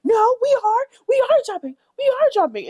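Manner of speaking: very high, loud whiny mock voice; mocking squeaky voice. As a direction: squeak it out like a prissy denial. Mocking cast members' reply.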